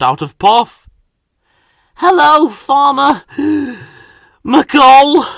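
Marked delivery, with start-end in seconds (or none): 2.0-5.3 higher and louder, gasping for breath mid-word. A narrator's reading. Exhausted Thomas greets the farmer, gasping for breath. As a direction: struggle for air between words, worn out. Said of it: "gasped Thomas".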